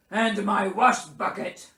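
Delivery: loud bear dictation; pompous Bear. A narrator's reading. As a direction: add it grandly to the will. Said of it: "added Bear".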